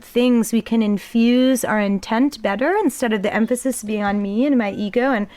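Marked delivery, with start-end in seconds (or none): none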